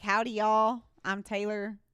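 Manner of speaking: loud, hammed-up country twang; country accent. Worker greeting drive-through customers, hammed-up country accent. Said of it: "The country one's pretty".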